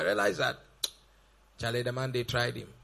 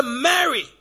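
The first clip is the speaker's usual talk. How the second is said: calling her name; 4 semitones higher, 5 dB louder than usual. Acted. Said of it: "Once he said".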